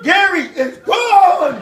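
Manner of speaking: slow, loud, high cry with laughter; panicked shout. Retold or imitated. Boy yelling to mother that brother fell out. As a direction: yell the news in alarm.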